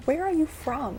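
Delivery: high, loud baffled question; incredulous demand. Pretend. Questioning the fake British rock star, incredulous. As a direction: demand an answer in disbelief.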